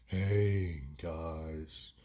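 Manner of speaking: low, soft and dragging; slowed-down speech. Acted. Wado comes round to his mates, speaking very slowly. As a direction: imitate a slowed mate, pausing between words. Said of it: "He's talking a lot slower".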